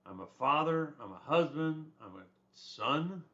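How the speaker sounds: ordinary delivery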